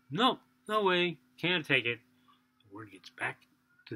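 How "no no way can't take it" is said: slow, louder flat refusal; firm refusal. Acted. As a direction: turn it down emphatically. Priests refuse the offering.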